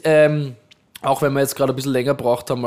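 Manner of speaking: normal talking voice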